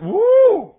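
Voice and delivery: very loud, high startled whoop; loud woo. Acted. Facing the giant, loud startled woo. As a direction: let out a big alarmed woo.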